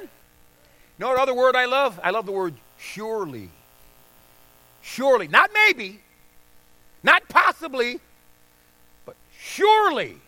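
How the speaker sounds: pulpit-pounding sermon peak; full-force preaching. Acted. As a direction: preach it hard to the congregation.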